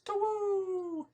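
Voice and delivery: high short owl hoot; a single high hoot. Acted. Owl ending its call, high hoot.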